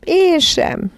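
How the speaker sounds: high-pitched whimper, lightly acted; a small child's understated wail; mild tearful cry. A narrator's reading. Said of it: "sírt Barbara".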